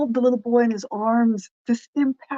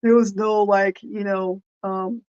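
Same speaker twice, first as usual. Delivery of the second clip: ordinary talk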